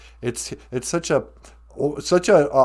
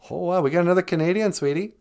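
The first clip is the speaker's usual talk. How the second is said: ordinary talk, nothing marked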